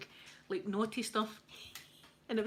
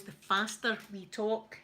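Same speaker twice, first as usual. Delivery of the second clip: ordinary talk, nothing marked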